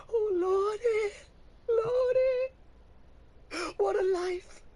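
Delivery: high, slow, crying wail; sobbing. Acted. Woman breaking down before the preacher, slow crying wail. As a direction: wail it through sobs. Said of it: "The woman starts to sob".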